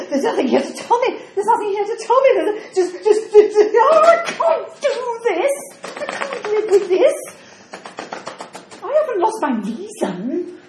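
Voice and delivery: flustered, laughing and near tears by turns; a bewildered woman panicking over her lost memory; rising distress, high and breaking; confused old woman. Acted.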